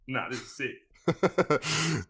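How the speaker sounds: slow, drawn-out delighted boast; stoked kid. Retold or imitated. Teen skipping school for sport, slow delighted boast. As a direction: savour every word like a cheeky kid. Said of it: "And I'm like".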